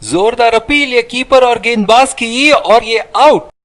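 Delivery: high, thrilled wicket call; excited cricket commentary. Acted. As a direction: ring out the dismissal. Appeal and wicket called. (उत्साहित cricket commentary)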